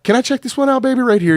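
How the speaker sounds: high-voiced imitation of a lady; imitated woman customer's request. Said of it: "She's like".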